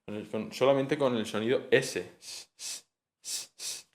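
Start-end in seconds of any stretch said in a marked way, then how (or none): 2.2-3.8 soft voiceless s sound, repeated; isolated s sounds, very soft; hissed s. Shown as a demonstration. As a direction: hiss a bare s several times. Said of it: "el sonido alveolar fricativo s".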